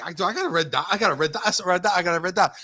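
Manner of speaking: can't believe his luck, giddy; excited disbelief. Retold or imitated. Excited about getting the red dot.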